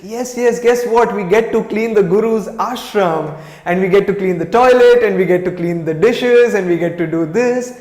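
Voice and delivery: thrilled about cleaning day; excited. Retold or imitated. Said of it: "she just got a sense of excitement".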